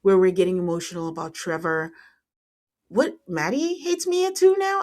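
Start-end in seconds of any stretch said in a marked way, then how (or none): 2.9-4.8 incredulous. Real, not acted. Bewildered reaction to reunion drama.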